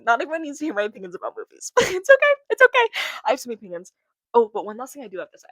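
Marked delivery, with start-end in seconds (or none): 1.8-2.9 high, loud laughing "It's okay"; squeaky laughing it's okay. Real, not acted. A girl laughing off her movie opinions, squeaky and loud.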